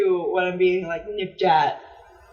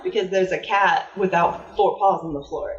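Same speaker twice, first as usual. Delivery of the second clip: ordinary talk, nothing marked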